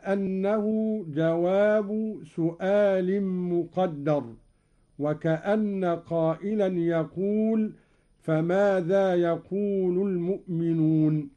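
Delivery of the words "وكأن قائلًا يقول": ordinary delivery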